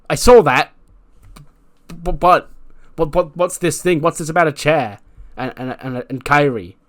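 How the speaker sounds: high, loud, stammering confusion; flustered questioning. Retold or imitated. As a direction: stumble over urgent, baffled questions. Acts out flustered Dakota questioning.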